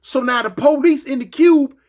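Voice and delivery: ordinary talk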